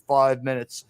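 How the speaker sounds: ordinary talk, nothing marked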